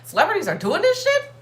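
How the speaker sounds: rising, stunned disbelief; high squeaky disbelief; shocked inner voice. Retold or imitated. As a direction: gasp it out as a shocked thought. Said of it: "I'm like".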